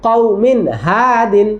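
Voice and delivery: কওমিন হাদিন drawn out very slowly, with the n kept clear; slow drill reading. Shown as a demonstration. Teacher modelling for the children, slow and clear. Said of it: "teacher drilling Quran reading slowly".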